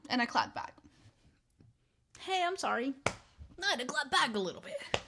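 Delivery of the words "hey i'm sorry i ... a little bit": high-pitched playful sorry; cutesy high self-excusing; mock apology. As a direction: plead sorry in a high, put-on voice. Mock apology for clapping back.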